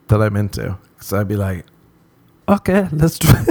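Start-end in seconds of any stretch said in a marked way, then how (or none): 2.5-3.4 high-pitched, comic caving in; mock giving in. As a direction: give in with a silly high voice. Imagines caving in to temptation.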